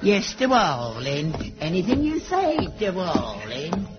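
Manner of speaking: drawn-out, mincing compliance; mock the actors with exaggerated sweet obedience; slow, sarcastic simpering; mock-obedient luvvie. Father mocks theatrical talk.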